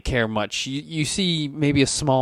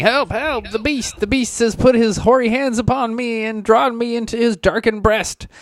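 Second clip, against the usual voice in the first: high-pitched hysterical wail; screaming preacher; 12 semitones higher than usual. Acted. Hugged priest cries out against the beast, high acted screaming. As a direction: cry for help in frenzied, high preacher's tones. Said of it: "he definitely starts screaming".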